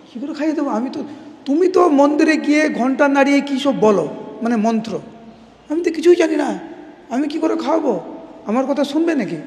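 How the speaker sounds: put-on a simple boy fretting he can't do it; innocent little boy. Young Madhu frets to his father about feeding God.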